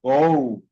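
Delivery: gol as "gou". Shown as a demonstration. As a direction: say gol with the L turned into a u. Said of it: "se pronuncia la L como la U".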